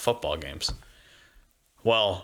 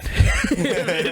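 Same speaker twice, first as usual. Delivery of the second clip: loud breathy laugh, higher; real burst of laughter; 9 semitones higher, 5 dB louder than usual. Real, not acted. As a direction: crack up out loud. Laughing at the voicemail mix-up.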